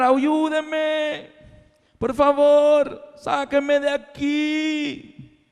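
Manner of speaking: high, long-drawn mock calling out; acted cry for help. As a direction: stretch each plea out high, as if calling up from a pit. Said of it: "Y comienzo a gritar".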